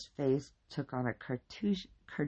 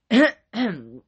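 pointed, loud ahem; loud throat clearing; 9 semitones higher, 15 dB louder than usual. A narrator's reading. Partner cuts off the rambling officer, clearing throat loudly. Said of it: "his partner loudly cleared his throat".

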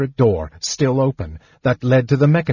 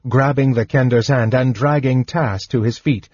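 ordinary delivery

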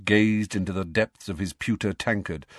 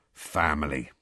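gloomy; 6 semitones lower, 4 dB quieter than usual. A narrator's reading. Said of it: "said Hagrid gloomily".

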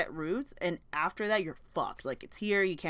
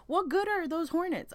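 higher, indignant demand; outraged question; 10 semitones higher than usual. Real, not acted. Vlogger venting to viewers about hornets, indignant. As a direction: ask it like you can't believe they exist. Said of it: "I'm sorry".

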